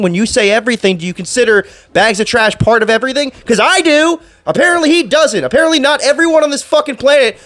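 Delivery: indignant rant. Real, not acted. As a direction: vent at the camera, fed up. Ranting about the boss.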